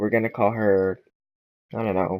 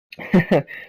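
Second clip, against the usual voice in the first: short laugh; 7 semitones higher than usual. Real, not acted. Laughing at own story.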